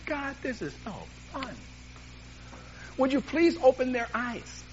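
imitated pleading prayer; begging God. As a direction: act out begging God, stretching the words. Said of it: "honestly begged God".

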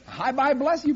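high, loud, hurried brush-off; rushed greeting. Retold or imitated. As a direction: fling the blessing over your shoulder.

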